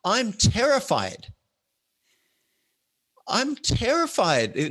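high, drawn-out mock panic; slow, high-pitched dramatised confession of fear; acted terror. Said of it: "by the time the mic gets to me".

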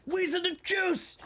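high, slow caveman grunt-talk; caveman Link voice. Retold or imitated. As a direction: blurt it like a caveman who barely knows words. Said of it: "Link comes out there spouting".

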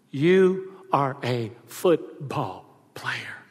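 slow, soft, weighty blessing; slowly, full of pride; slow emphatic. Retold or imitated. Father hugging son after the championship, slow.

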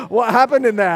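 loud gasp, then high question; imitated astonishment. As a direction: gasp and ask as the stunned friend. Startled waiting friend, acted.

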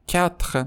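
کتخ with no u sound after k and a guttural kh; کتخ like French quatre, never کواتخ. Shown as a demonstration. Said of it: "نمیگیم کواتخ".

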